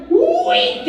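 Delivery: comic high-pitched outburst; mock yell. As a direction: yelp it way up high, gleefully silly. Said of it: "we both yell out".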